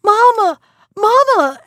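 high, loud cry for mamma; frightened girl screams for her mother. Acted. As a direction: call out for your mother in fright.

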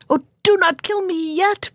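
small high fish voice, pleading; begging fish. Acted. Little fish begs the fishermen, high pleading voice. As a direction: plead for its life in a tiny high voice. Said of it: "begged the fish".

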